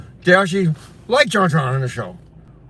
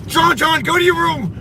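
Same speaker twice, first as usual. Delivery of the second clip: much higher, firm shouted order; mock stern father; 10 semitones higher than usual. Teasing John John with his father's scolding, stern. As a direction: send the kid off like his dad.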